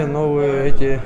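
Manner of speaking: usual speaking voice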